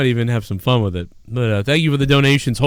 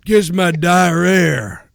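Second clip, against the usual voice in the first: slow, drawn-out, higher mocking wrestler voice; Jake Roberts impression; 4 semitones higher, 3 dB louder, much slower than usual. Riffing on the diarrhea line, slow put-on wrestler voice. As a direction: stretch it out as a put-on wrestler taunt. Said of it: "it's me, Jake Roberts".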